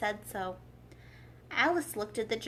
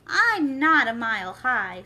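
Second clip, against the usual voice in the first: loud, slowed protest; indignant protest; 11 dB louder, slower than usual. A narrator's reading. Alice protesting against the King's rule, loud. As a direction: push back hard.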